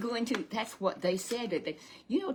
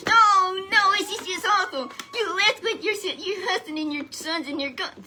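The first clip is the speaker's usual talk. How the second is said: much higher and louder cry of dismay; shocked dismay; 13 semitones higher, 10 dB louder than usual. Retold or imitated. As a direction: gasp out the bad news in horror. Townspeople hearing of deaths.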